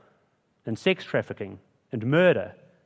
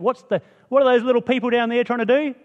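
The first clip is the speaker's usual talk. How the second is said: high, loud, amused disbelief; God looking down, mocking the builders; 11 semitones higher, 6 dB louder than usual. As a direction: peer down at little people and scoff. God peering down at tiny people.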